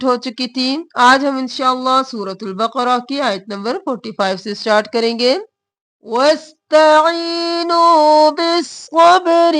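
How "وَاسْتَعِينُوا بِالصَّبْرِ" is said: slow tajweed chant with lengthened vowels; Quranic recitation. Shown as a demonstration. Teacher reciting the verse to students, slow chant. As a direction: stretch each word as a qari would.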